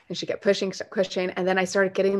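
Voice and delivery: normal talking voice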